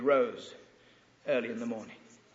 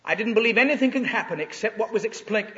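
usual speaking voice

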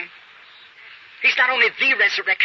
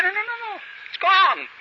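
emphatic refusal; 12 semitones higher than usual. Retold or imitated. Ex-alcoholic refusing sake, emphatic.

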